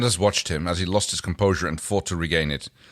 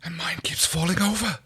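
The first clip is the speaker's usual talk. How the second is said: whispered, higher whiny complaint; 10 semitones higher than usual. A narrator's reading. Chiana complaining about her figure in a whisper. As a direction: moan it under your breath. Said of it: "Chiana complained".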